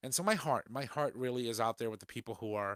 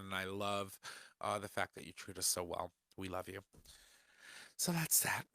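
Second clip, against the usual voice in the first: normal talking voice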